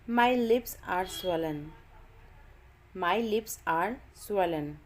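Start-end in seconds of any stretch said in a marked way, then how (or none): none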